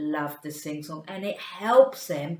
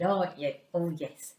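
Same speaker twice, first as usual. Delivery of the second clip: normal talking voice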